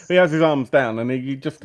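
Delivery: normal talking voice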